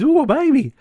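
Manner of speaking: high squeal of delight on ooh; delighted Claire. Retold or imitated. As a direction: gush over the baby in a high voice. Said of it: "comes out of her room and goes".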